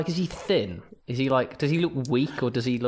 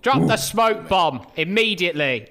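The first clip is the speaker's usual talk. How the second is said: calling from far away; 5 semitones higher than usual. Pretend. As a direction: shout it over to a friend. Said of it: "I'm gonna shout over to you".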